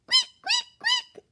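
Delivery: very high squeaky mouse; squeaking little mouse. A narrator's reading.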